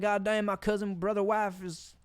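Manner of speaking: hammed-up Southern comeback; mock Southern accent. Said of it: "might not fit in with my accent".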